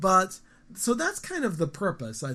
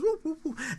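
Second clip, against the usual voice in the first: higher, softer playful woof imitation; dog barking; 11 semitones higher, 3 dB quieter than usual. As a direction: woof like the same dog, now just friendly. Dog greets owner again.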